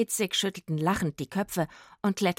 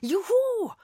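very high cheer with a held "hu"; jubilant whoop; 14 semitones higher, 3 dB louder than usual. A narrator's reading. Rufus runs toward the cherry trees, jubilant whoop. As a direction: whoop it out for joy. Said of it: "jauchzte er".

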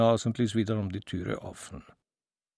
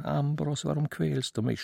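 plain reading voice

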